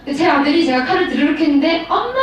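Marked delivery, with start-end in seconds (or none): 1.9-2.1 put-on child calling mum. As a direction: call out like a child running over. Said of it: "이러면서 달려왔어".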